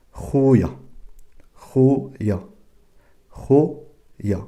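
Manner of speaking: Khouya with a throaty kh at the start; Darija kh sound. Shown as a demonstration. Teacher modelling the kh of Khouya for French learners, careful. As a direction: rasp the kh in Khouya from the back of the throat. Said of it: "je la prononce kha".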